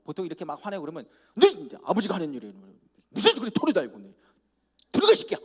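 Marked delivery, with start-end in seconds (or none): 1.3-5.4 imitating an ordinary father yelling at his son; angry father. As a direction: blow up at your son. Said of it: "보통 우리 아버지는 그러잖아요".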